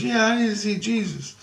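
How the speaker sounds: put-on high little-girl voice; twelve-year-old girl. Little girl asks a stranger for Jesus, high child voice. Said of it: "she talking like she's twelve years old".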